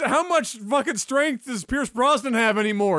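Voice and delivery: high, loud rebuttal; heated arguing. Real, not acted. Podcaster defending an older actor, heated arguing. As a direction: argue it at the top of your range. Heated playful argument over pick.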